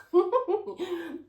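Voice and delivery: high, loud burst of laughter; short high laugh. Real, not acted. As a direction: laugh out at a pitch above speech. Own laugh after her remark.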